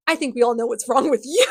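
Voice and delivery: loud sneer shooting up at the end; mock sneer. Villain turns on Alec, hammed-up loud sneer. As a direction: sneer that breaks toward laughter on "you". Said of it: "he directed his next sneer at Alec".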